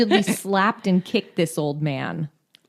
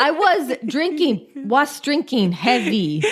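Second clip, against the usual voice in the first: put-on German accent; 6 semitones higher than usual. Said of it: "mimicked Schultz's German accent".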